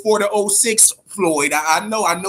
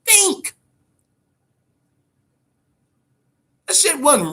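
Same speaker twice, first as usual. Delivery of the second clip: pitched way up, ranting at the doubters; heated rant; 10 semitones higher than usual. Real, not acted. Ranting at fans who think the fight was rigged, exasperated and high.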